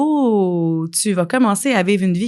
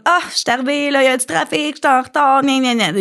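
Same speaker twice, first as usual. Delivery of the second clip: higher, mock whiny excuse; whiny complainer; 5 semitones higher than usual. Late student complaining to coach, higher mock whine. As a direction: imitate someone griping about traffic. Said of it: "quelqu'un fasse comme".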